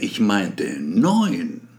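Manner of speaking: neun drawn out slow and falling; stress on neun. Acted. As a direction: ich meinte neun, slowly, landing hard on neun. Correcting the number.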